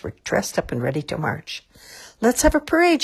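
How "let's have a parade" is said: high, loud rallying shout. A narrator's reading. Mister Macy starts the parade, shouting. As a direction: announce it to a whole crowd. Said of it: "shouted Mister Macy".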